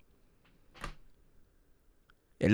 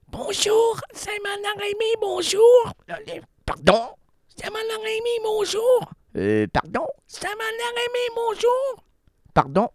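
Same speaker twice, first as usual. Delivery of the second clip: very high cracking falsetto greeting; broken voice on phone. Retold or imitated. Receptionist with a lost voice answering clients. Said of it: "quand je vais dire au client".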